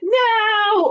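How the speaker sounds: high, loud cry of no; a sharp, high, loud shout; dinosaur yell. Acted. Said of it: "trio of dinosaurs yelling".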